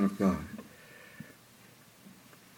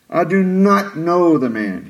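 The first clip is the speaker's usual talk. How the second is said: slow, emphatic denial; slow oath; 5 semitones higher, 4 dB louder, slower than usual. Retold or imitated. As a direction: lean on "not" with each word spaced. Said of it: "this time with an oath".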